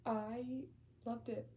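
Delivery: ordinary talk, nothing marked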